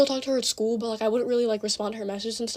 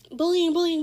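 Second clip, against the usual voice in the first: high-pitched mocking chant; mocking accusation; 6 semitones higher, 4 dB louder than usual. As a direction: parrot their complaint in a higher voice. Mimics accusers at her school.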